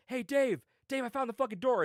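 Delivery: mock shout to a friend across the room; excited calling out. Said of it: "He's like".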